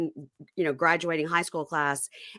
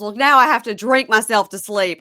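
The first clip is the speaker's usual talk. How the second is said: higher, louder quote of a dramatic line; quoting a catchphrase; 5 semitones higher, 7 dB louder than usual. Retold or imitated. Fan quotes the character's favourite line, louder and higher. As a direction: deliver her catchphrase with relish. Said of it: "so many catchphrases from her".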